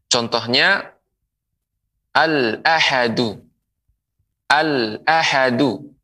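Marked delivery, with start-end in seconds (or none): 2.2-5.9 slow, careful "al-ahadu" with a clear l; clear al pronounced. Shown as a demonstration. Teacher modelling "al-ahadu" slowly to viewers. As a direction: spell out "al-ahadu" for students, slowly. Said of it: "Al di sini dibaca dengan je-, jelas".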